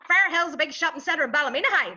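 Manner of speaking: high-pitched Ballymena accent with plenty of twang; twangy Ballymena accent. Shown as a demonstration. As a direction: pile on the nasal Ballymena twang. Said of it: "That's plenty of twang in it".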